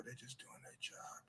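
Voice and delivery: very soft, voiceless murmur; understated reply. Acted. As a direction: answer almost under your breath. Jeff's unbothered reply to tabloid.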